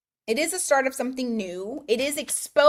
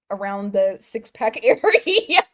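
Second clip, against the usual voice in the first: louder, breaking into laughter mid-phrase; laughing; 6 dB louder than usual. Real, not acted. Reader asking Dawn about losing weight, laughing. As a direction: lose it laughing before the last word. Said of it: "that's why I'm laughing".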